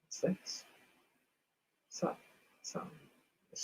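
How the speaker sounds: what's this breathed out as a whisper, no voice; whispered repeat. Real, not acted. Streamer peering at the odd thing, whispering it again.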